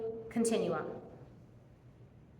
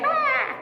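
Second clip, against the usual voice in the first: sudden high yelp of mock panic; panicked yelp; 13 semitones higher than usual. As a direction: let out a quick high squeal of overwhelm. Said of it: "And she was like".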